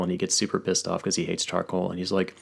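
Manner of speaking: ordinary delivery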